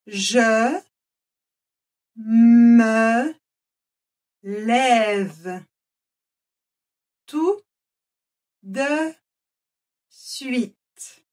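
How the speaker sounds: slow, one word at a time with pauses; word-by-word dictation. Shown as a demonstration. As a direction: dictate each word separately. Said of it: "je vais parler lentement". (dictée mot par mot)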